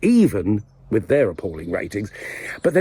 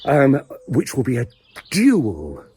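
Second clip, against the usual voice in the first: ordinary talk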